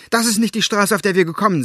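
loud, high call. A narrator's reading. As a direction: call it out over the noise. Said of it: "rief Elinor".

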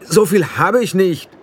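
put-on boy calling out in dismay; called out. A narrator's reading. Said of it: "rief Roland".

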